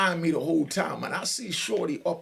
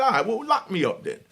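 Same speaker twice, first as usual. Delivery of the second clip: loud cocky dare, pitched up; defiant dare; 7 semitones higher, 4 dB louder than usual. Retold or imitated. Inmate daring the officer to arrest him, loud. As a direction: throw it back at her, daring.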